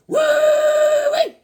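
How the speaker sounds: very high whoop. Real, not acted. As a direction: cheer at the cover. Excited over cover.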